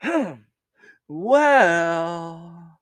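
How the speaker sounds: drawn-out thoughtful well; hammed-up musing; mock pondering. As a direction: stretch it out thinking. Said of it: "I go".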